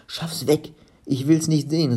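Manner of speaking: high, loud, upset outcry; upset cry. A narrator's reading. Olli crying out at the stolen piggy bank, high upset outcry. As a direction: push the thing away with your voice. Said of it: "rief Olli".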